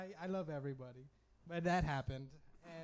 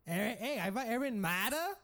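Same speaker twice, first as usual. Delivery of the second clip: loud, higher jeering at the name; taunting; 6 semitones higher, 6 dB louder than usual. Retold or imitated. Kids hazing the new kid, loud jeering. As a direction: jeer his name loudly. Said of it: "Hazing, like".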